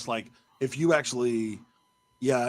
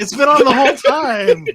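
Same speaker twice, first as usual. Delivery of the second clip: much higher, louder alarmed cry; sudden embarrassed shock; dismayed realisation; 12 semitones higher, 11 dB louder than usual. Real, not acted. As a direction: blurt it out in horror.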